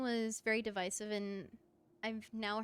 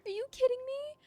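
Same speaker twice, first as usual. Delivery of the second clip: much higher, louder squealing delight; excited squeal; 13 semitones higher, 11 dB louder than usual. Real, not acted. Gamer squealing at penguins on screen. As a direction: shriek with joy at the penguins.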